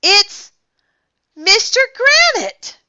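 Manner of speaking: high, loud cry of recognition; excited high shout. A narrator's reading. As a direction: yell it out in amazement. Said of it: "Ryan shouted".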